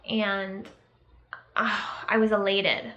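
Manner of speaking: ordinary talk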